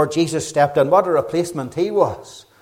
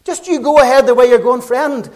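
higher and louder, a light parody; hint of a careless street preacher; mock glib preacher; 8 semitones higher, 5 dB louder than usual. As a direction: send up the easy gospel. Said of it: "What he was saying is".

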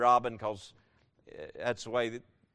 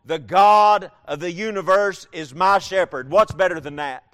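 rising, louder sermon climax; fervent preacher voice, higher and louder; preaching at full force; 5 semitones higher, 8 dB louder than usual. Acted. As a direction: build it up like a revival preacher.

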